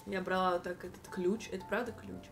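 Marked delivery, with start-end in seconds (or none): none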